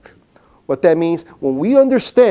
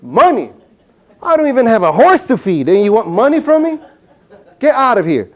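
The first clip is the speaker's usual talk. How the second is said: mock indignant shout, louder; indignant outburst; 4 semitones higher, 4 dB louder than usual. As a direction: scoff at the demand, hammed-up. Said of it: "What would be his reaction to this guy".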